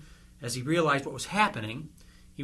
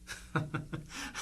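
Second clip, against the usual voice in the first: laugh; 8 dB quieter than usual. Acted. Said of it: "I just had to laugh".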